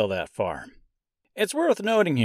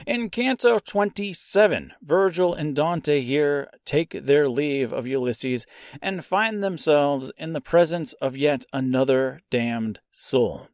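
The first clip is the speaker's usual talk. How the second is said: usual speaking voice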